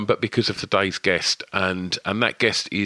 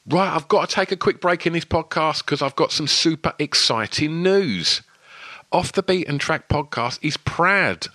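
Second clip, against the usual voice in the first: upbeat, higher sponsor announcement; excited ad read; 4 semitones higher than usual. Acted. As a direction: sell it like big news. Host reads sponsor ad.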